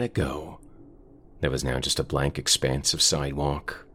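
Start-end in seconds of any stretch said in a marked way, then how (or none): none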